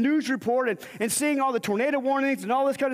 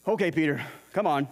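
low, resigned okay come on; lower, weary go on then; resigned Jesus; 10 semitones lower than usual. Retold or imitated. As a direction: give in with a sigh, voiced lower. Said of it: "So Jesus is like".

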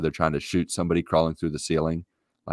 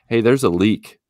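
called out loud from another floor; yelling from upstairs; 5 semitones higher, 7 dB louder than usual. Retold or imitated. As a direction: raise the voice to call upstairs. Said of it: "Mindy yells from upstairs".

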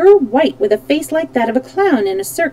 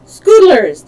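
loud, higher yelled answer; sharp shout, pitched up; sharp, shrill yell; 5 semitones higher, 10 dB louder than usual. A narrator's reading. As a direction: yell it like a whole chorus answering. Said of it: "yelled in chorus, their voices sharp and shrill".

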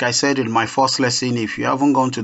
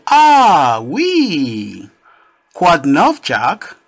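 bright high excited "Ah, oui. Quoi de neuf"; high, louder excited hello; excited greeting; 4 semitones higher, 5 dB louder, much slower than usual. Acted. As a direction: greet a friend with a happy squeal. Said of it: "Mary, was excited".

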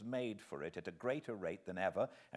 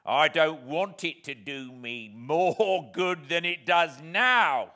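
loud, slow, with a gloating laugh; greedy glee; 5 semitones higher, 10 dB louder than usual. Acted. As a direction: chuckle greedily over his revenge. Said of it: "with a greedy laugh".

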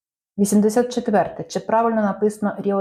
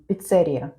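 Піцерія stressed on the second syllable, пі-ЦЕ-рія; 3 semitones lower than usual. Shown as a demonstration. Quiz host gives the answer, Піцерія stressed on ЦЕ. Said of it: "На другому".

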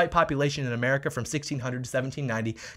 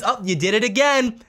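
taunting; 8 semitones higher, 6 dB louder than usual. Real, not acted. Taunting the celebrity.

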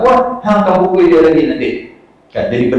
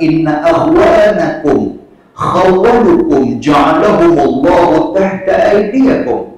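slow Arabic recitation; 4 semitones higher, 3 dB louder, much slower than usual. Acted. Prophet's words recited in Arabic.

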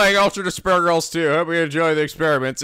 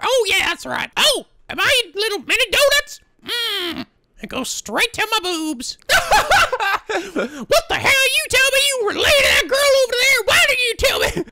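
put-on shrill girly voice; 3 dB louder than usual. Character voice, shrill.

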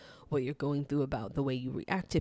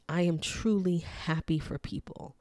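ordinary talk

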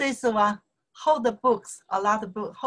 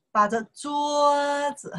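桌 in 把着桌子 held long on a high level pitch; slow, sustained teaching demonstration; drawn-out model phrase; 5 semitones higher, slower than usual. Shown as a demonstration. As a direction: stretch out 把着桌子 slowly for a learner. Teacher models the phrase, then repeats.

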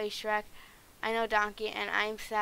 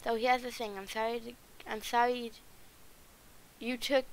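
normal talking voice

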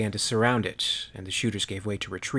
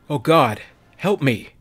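higher, louder screaming plea; terrified scream; 5 semitones higher, 7 dB louder than usual. Acted. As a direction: scream for help in terror. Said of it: "Carlston screamed".

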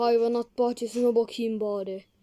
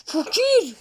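calling out; 8 semitones higher, 5 dB louder than usual. Pretend. Calling out again.

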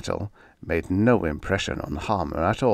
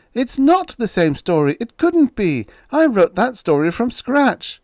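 much higher, louder desperate protest; shrieking denial; 7 dB louder than usual. Acted. Exposed writer shrieking denials at his editor. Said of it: "Now his voice was a shriek".

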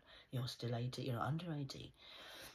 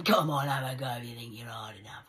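defiant taunt; 9 dB louder than usual. Retold or imitated. Daring the attackers in the road.

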